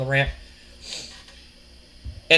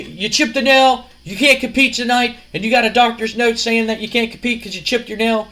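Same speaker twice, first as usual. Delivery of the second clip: louder, higher needling challenge; mocking taunt; 4 semitones higher, 3 dB louder than usual. Wrestler taunts an injured rival before the match, mocking. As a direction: pepper them with scornful questions. Owen taunts Kurt in promo.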